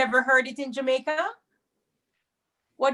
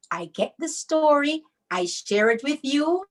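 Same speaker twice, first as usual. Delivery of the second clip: ordinary talk, nothing marked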